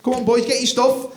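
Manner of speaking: high, loud, brisk fake-cheerful orders; hurried cheerful dad. Dad to boys on Scotland trip, loud fake cheer. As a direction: herd the kids out fast, jolly. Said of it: "you know, just".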